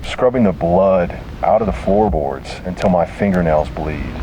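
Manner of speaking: plain reading voice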